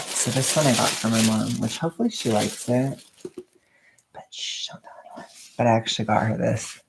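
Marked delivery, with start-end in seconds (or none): 4.1-5.3 hushed whisper, sharing a secret. Real, not acted. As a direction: whisper so mom can't hear. Secret about mom's gift.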